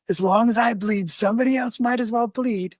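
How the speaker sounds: high-pitched imitation of a ranting drunk; retold threat, pitched well up; worked up. Bleeding drunk threatens the missionaries, imitated as worked up. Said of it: "getting all worked up".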